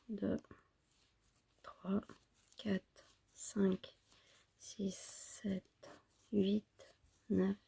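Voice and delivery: barely audible murmured counting; counting under breath. Real, not acted. Counting stitches under her breath. (compter à voix basse)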